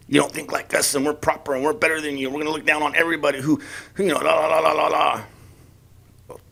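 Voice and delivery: soft mock-superior talk trailing into la-la babble; mimicking smug superiority. Imitating how the left talks down, mock superiority. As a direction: look down on everybody, in mockery. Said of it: "the way the left talks".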